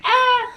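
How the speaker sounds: high-pitched "ah" of frustration; short, high acted-out yelp; mock frustrated cry. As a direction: imitate a frustrated squeal. Acts out throwing surfboard in frustration.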